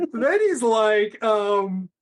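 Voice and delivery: ordinary delivery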